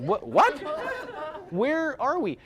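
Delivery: high, loud mock bafflement, laughing through it; high, loud, amused what-where-are-we; incredulous disbelief. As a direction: act baffled at the fake Texan. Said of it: "and I'm like".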